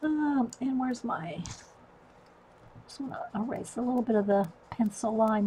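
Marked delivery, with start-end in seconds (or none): none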